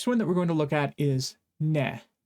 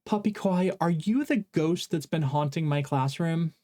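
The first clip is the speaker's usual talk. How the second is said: ordinary talk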